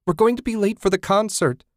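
high, loud tearful plea; crying out to her dead friend; desperate cry. A narrator's reading. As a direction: plead through panic. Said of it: "she cried".

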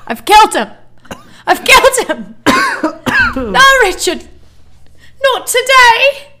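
high, loud mock panic; mock panicked yelling. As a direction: overdramatic shrieking alarm. Mock panic over laughing friend.

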